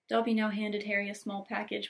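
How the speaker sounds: plain reading voice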